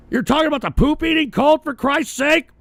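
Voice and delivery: high, fast, loud disbelief; incredulous outburst. Real, not acted. Host answering a viewer about joining a cult, incredulous. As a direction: fire it back in exasperated disbelief.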